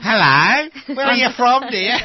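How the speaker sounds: loud, high-pitched mock-friendly busybody; nosy stranger. Stranger asking the woman where she's from, mock nosy voice. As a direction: call it out high and loud, patronising. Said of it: "where is she from?".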